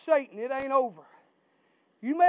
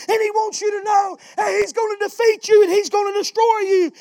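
loud, higher preaching build; full-force preaching; 4 semitones higher, 5 dB louder than usual. Real, not acted. Pastor warning the church about Satan, loud preaching.

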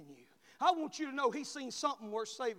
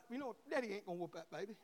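ordinary delivery

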